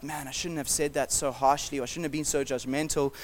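quiet regretful inner voice; quiet self-reproach. Retold or imitated. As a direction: hush it like a pang of conscience. Voiced regret over harsh words.

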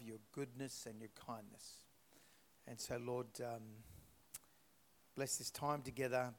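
soft, earnest church prayer. Acted. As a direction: pray it quietly and reverently for the room. Leads a prayer.

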